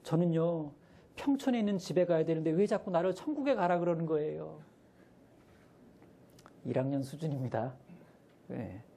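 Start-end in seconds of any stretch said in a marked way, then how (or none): 0.0-4.6 higher earnest first-grader voice; higher child explaining himself; child protesting. Retold or imitated. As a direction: complain like a confused little kid. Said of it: "이 아이가".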